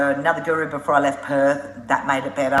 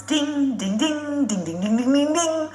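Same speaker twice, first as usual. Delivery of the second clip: high singsong dinging; phone alert imitation; 11 semitones higher, slower than usual. Passengers' phones all going off in the bar, high chiming imitation. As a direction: chime like a string of phone alerts.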